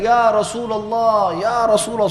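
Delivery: high, long-drawn calling shout; Abu Bakar. Retold or imitated. Abu Bakar hails the Prophet from afar, calling out. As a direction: call out deliberately from far off. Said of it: "Abu Bakar teriak".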